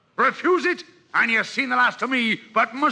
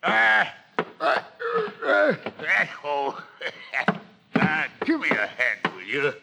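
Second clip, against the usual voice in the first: grunting, puffing effort with a strained chuckle; straining climb; 4 dB quieter than usual. Acted. Silver struggles over the stockade wall, grunting with effort. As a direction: heave yourself over a wall on one leg.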